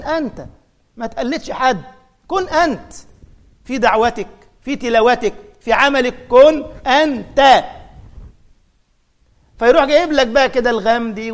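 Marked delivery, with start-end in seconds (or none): 6.3-7.6 slow, loud emphatic "كن انت"; emphatic command. Shown as a demonstration. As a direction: stretch and punch "كن انت" slowly. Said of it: "كثير ما اقول للطلبة". ("كن انت" ببطء وبقوة)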